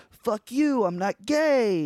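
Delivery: high, loud reenacted yell; over-the-top teenage protest; angry outburst. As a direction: shout it back at bullies, dragging the last word. Acting out his teenage outburst.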